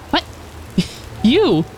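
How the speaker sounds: jumpy, high startled cry. Acted. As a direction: caught off guard, whipping round. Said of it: "she'll probably jump a bit at the voice".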